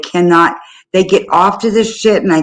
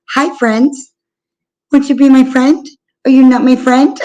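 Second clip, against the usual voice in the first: higher, mock-sweet pleading for friends; over-the-top sweet voice; 5 semitones higher than usual. Retelling how she greeted strangers in the room, hammed-up sweet voice. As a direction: ham up a syrupy, needy greeter. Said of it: "it was over the top".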